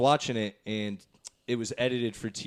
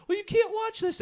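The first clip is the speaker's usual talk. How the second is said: much higher put-on grandma scolding. Grandma catching grandson watching an R movie, high scold. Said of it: "the tone of voice".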